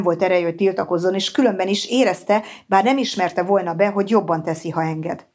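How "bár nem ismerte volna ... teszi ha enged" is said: ordinary delivery, nothing marked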